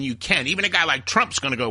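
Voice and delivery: ordinary talk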